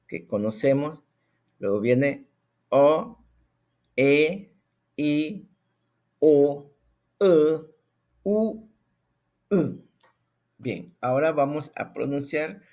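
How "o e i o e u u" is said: each vowel, 'O' 'E' 'I' 'U', called out higher and louder; vowel sequence demo. Shown as a demonstration. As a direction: recite the Ngäbere vowels one by one. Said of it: "de esta manera".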